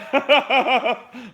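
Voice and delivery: a high, loud mocking laugh. Retelling the man laughing at the kid's punches, a high mocking laugh. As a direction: laugh it off like the punches are nothing. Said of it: "and the dude laughs".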